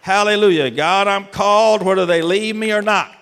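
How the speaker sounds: loud, high fervent preaching; preaching at full force. Acted. Preacher crying out to God before the congregation, fervent. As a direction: build to a cry, ending on Oh God.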